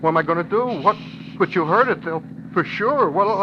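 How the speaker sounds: high, rising, breathless fright; guilty man panicking after the radio bulletin; panic. Acted. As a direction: voice climbing as the fear takes over. Man panics over news of accident.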